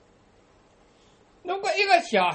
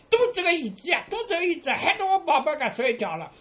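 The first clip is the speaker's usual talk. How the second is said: higher-pitched granny blaming the chair; mock scolding; 7 semitones higher than usual. Grandmother blames the chair to comfort her grandchild, mock scolding. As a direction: pretend to tell off the chair for baby's sake.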